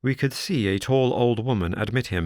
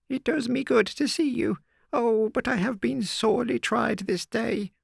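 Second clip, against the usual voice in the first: put-on much higher old woman's voice, overjoyed; old housekeeper rejoicing. A narrator's reading. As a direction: gush with relief like an old servant.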